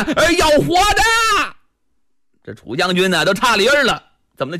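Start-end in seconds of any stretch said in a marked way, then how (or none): 0.0-1.0 urgent shouted order. Acted. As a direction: shout it across the fight. Ordering men to capture alive.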